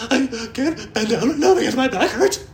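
tearful worker refusing his boss; crying whine. Retold or imitated. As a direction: blubber the excuse.

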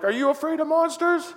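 high, playful questioning lilt; playful high-pitched question; dad to toddler. Retold or imitated. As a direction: ask it sing-song, as to a small child. Father asking his toddler at play.

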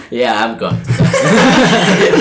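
slow, laughing through the words; resigned laughing. Acted. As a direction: give up with a laugh, slowly. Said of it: "he goes".

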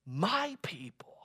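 soft, slow whisper of my people. Real, not acted. Preacher to his church, whispering God's tender words. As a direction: breathe it like a secret.

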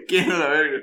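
words pushed out through laughter; real laughter over the words; laughing while speaking. Real, not acted. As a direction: say it still laughing. Laughing at his own answer.

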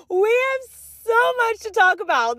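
high, bright, emphatic announcement; excited. Real, not acted. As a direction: say it clean and excited. Clean restart of the line.

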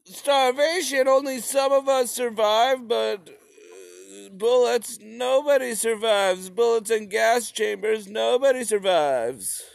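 high, whiny reasoning voice; mock Ukrainian peasant. Ukrainians weighing starvation against bullets, high and whiny. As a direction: whine it like a resigned peasant. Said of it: "Just like".

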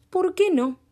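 high, loud playful retort; cheerful old man. Acted. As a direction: toss it back lightly.

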